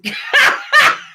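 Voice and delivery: very high burst of laughter; high, helpless real laugh. Real, not acted. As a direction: crack up mid-read. Cracks up reading comment.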